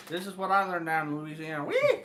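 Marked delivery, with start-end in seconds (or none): 1.6-2.0 high, playful "whee"; joking high-pitched squeal. Pretend. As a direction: let out a high, loud whee. Mock squeal about Louisiana.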